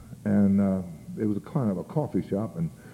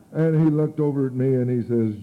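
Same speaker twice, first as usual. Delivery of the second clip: normal talking voice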